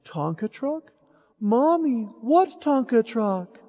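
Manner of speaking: lightly voiced innocent boy feigning ignorance. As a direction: play dumb like a seven-year-old. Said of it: "very innocently to Mommy".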